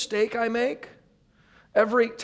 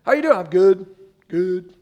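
a quick bright greeting and a brushed-off answer; mock breezy small talk; 5 dB louder than usual. Preacher acting out small talk to the congregation, breezy and fake. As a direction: act out the automatic cheerful reply. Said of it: "You ever say that?".

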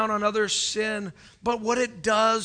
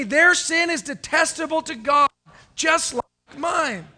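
louder repeat, with just like mine slowed; congregation echo; 7 semitones higher, 8 dB louder than usual. Real, not acted. Preacher leads the church in a loud call-and-response. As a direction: say it back loud with the crowd. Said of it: "Would you say that with me?".

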